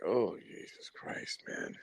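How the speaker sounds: slow, breathless whisper of disbelief; whispered dismay. Retold or imitated. Muttering to himself about the woman, slow whisper. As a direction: hush it out like you can't believe it. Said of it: "I'm like".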